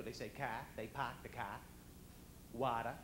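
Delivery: "car" and "Water" with the r dropped; broad Boston vowel in "car", no final r on "Water"; Boston pronunciation. Shown as a demonstration. Showing how Bostonians talk, "car" and "Water" without r. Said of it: "Well, they talk like that".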